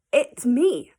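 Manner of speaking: gleeful reveal. Real, not acted. Punchline confession.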